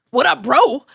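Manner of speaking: a woman greeting her as if she were a man; loud mock bro greeting. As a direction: bark it like a guy greeting his buddy.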